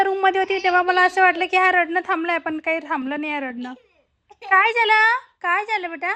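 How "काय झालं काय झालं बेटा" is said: concerned call. Real, not acted. Baby crying, mother calls. (रडणाऱ्या बाळाला आईची मोठ्याने विचारणा)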